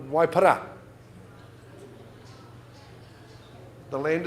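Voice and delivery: brisk auction patter; auctioneer calling bids. Acted. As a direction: sell it from the rostrum.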